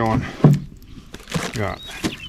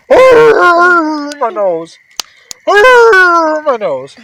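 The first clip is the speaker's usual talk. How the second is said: high, loud yelping dog cry; pained dog yelp; 11 dB louder, much slower than usual. Acted. Dog yelping after a porcupine quill, high loud howl. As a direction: yelp it like a dog stung on the nose. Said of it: "And he goes".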